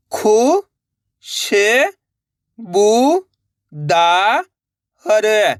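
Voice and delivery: each syllable held long and separate, vowels swooping up, last one dropping. Shown as a demonstration. Teacher sounding out a word for learners, syllable by syllable. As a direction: spell it out sound by sound with pauses. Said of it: "एक अक्षर का उच्चारण करना है".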